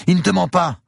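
very high, loud female voice; urgent insistence. A narrator's reading. Woman vouching for her man to the gunman, urgent. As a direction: urgently back him up. Woman backs captive under threat.

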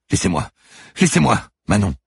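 high, loud plea to be left alone; escalating frightened shout; panicked cry. A narrator's reading. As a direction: cry out, fending someone off. Manon fights off whoever approaches.

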